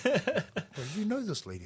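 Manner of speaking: put-on half-laughing disbelief from the boys; stunned bandmate. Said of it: "Everyone's just like".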